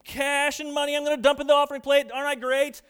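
loud, high, hammed-up show-off; mock braggart. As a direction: crow about the money for all to hear. Hypocrite boasting at offering.

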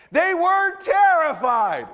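high, loud, drawn-out climax; dramatic reading. A narrator's reading. Preacher reading the storm story to the congregation, dramatic shout. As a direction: blow up the line for mock horror.

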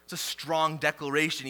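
ordinary talk

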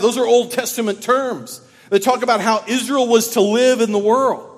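loud, fast, rising preacher voice; fiery preaching. Acted. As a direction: preach it with full fire. Sermon at full force.

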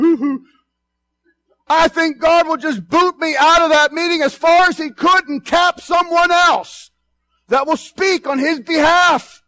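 fervent, loud and high preaching. Acted. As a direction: preach it out with full force. Preacher at full force.

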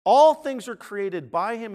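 "All" launched loud and high; stress on All. Real, not acted. Preacher rereading the verse to his congregation, loud stress on "All". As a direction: hit "All" hard at the start. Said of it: "I was emphasizing that word".

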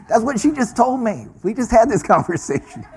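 high-pitched, laughing at himself; self-mocking exasperation. Kicking himself over clumsy small talk, high and laughing. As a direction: replay your own blunder in a high, amused voice.

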